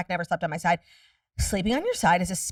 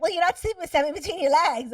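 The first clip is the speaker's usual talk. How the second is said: higher, fast, lightly put-on voice of the know-it-all; playful higher voice mocking the advice-givers; 8 semitones higher, faster than usual. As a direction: imitate the smug people telling her off. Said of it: "They're like".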